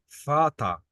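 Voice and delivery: فاتا with V said as F; فاتا starting with a clear f sound; German word modelled. Shown as a demonstration. Teacher showing German V as F. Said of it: "ال V ده هو ال F".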